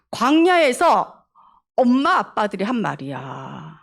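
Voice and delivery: ordinary talk, nothing marked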